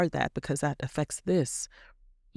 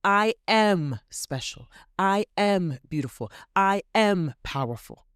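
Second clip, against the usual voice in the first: higher, slow and proud, each "am" leaned on; said with your chest; 5 semitones higher, slower than usual. Shown as a demonstration. Coach models bold affirmations for listeners, slow and proud. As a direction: declare every "I am" like you own it. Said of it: "say with your chest".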